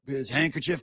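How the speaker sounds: ordinary talk, nothing marked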